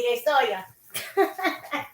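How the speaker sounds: drawn out slowly through laughter; laughing. Real, not acted. Teasing the hiding puppy, slow and laughing. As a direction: giggle the words out at a snail's pace.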